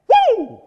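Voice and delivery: loud, high exultant "woo"; joyful whoop. Real, not acted. Preacher thrilled by scripture, loud whoop. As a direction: whoop for joy.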